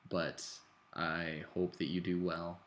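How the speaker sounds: ordinary delivery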